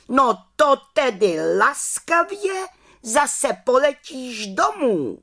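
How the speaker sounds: head witch orders the little witch home; commanding head witch. Acted. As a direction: send her home with authority. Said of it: "přikázala vrchní čarodějnice".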